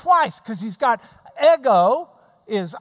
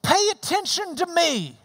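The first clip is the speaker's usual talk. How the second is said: higher, insistent command; Paul's urgent plea; 7 semitones higher than usual. Retold or imitated. Preacher voicing Paul demanding the church's attention. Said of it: "This is".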